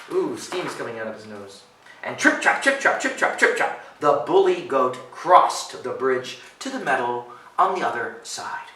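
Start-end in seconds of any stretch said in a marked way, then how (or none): 2.2-3.8 crisp voiceless trip-trap; hoofbeat imitation. Reader mimicking Ruff crossing bridge, percussive patter. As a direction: rap out the hoofsteps sharply. Voicing goat hooves on bridge.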